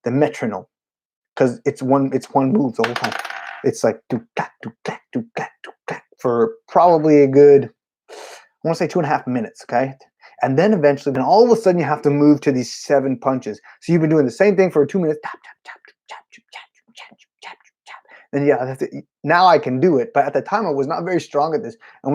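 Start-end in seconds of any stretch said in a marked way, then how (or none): 3.6-5.7 soft unvoiced do ka drum beat; whispered beatbox. Drummer showing the beat in a soft whisper. 15.2-18.0 very soft unvoiced tap tap; whispered taps. Drummer miming the endless beat in a whisper.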